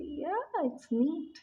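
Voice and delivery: "Yeah, it's neat" pitched high and drawn out slowly; curt casual reply. Retold or imitated. Husband answering his wife's gift, high, slow and offhand. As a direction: play an unimpressed partner, high and slow. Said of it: "They say".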